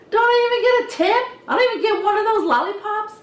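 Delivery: high, quick, loud playful whine; mock-protest fast and high, as a tease; teasing mock complaint. Hairdresser giving a candy-laden kid a hard time, mock complaint. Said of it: "give him a hard time, mess with them".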